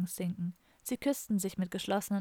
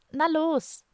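higher, louder push to get him moving; urging on; 8 semitones higher, 5 dB louder than usual. A narrator's reading. Woman driving her hesitant boyfriend to jump, urging. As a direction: egg him on loudly. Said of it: "trieb sie ihn an".

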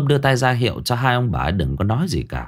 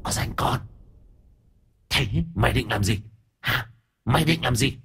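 hissing spirit; 2 semitones higher, 5 dB quieter than usual. Acted. Spirit challenging Thơm.

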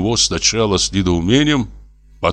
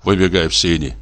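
plain reading voice

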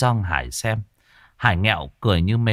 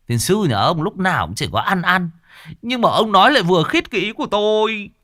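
higher, louder teasing scold; mock scolding; 9 semitones higher, 4 dB louder than usual. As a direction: scold him, then agree, laughing inside. Boss scolds then agrees. (giọng mắng yêu)

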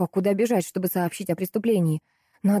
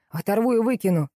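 mild threatening yell; growing threat, moderately shouted; angry yelling; 3 semitones higher than usual. A narrator's reading. Butcher threatens his coworkers, moderately angry yell. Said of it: "вопил парень".

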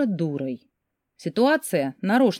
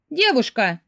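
higher, mildly irritated call; 6 semitones higher, slower than usual. A narrator's reading. Customer calls the shop assistant, irritated. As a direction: summon her with a touch of annoyance. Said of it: "раздражённо обратилась она к консультанту".

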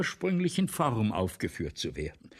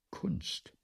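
whisper; 6 semitones higher, 5 dB quieter than usual. Acted. Writer sneers at "art.

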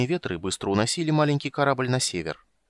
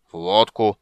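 louder, seething repeat; furious; 6 dB louder, slower than usual. Acted. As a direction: spit it back in rising rage. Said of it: "свирепея повторил Флинт".

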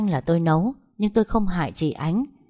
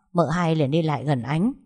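ordinary delivery, nothing marked

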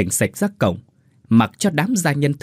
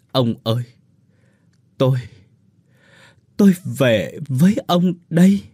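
broken wail; 3 semitones higher, 3 dB louder than usual. Acted. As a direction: cry out to the dead husband in pieces. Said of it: "gào lên từng hồi, rồi đứt quãng". (gào khóc đứt quãng)

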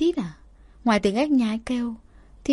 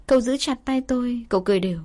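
plain reading voice

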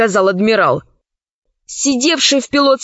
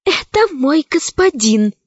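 higher, slow fearful cry; frightened slave girl; 3 semitones higher than usual. A narrator's reading. Zauri seeing her master, frightened. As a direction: shrink at the sight.

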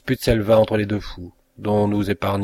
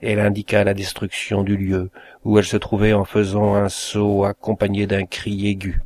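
ordinary delivery

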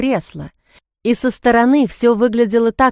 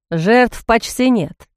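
usual speaking voice

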